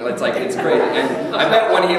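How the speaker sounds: ordinary talk, nothing marked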